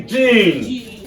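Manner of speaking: "gene" stretched out with emphasis; stressed syllable. Shown as a demonstration. Teacher shows class where to find the stress, "gene" held long. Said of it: "Where can we find the stress?".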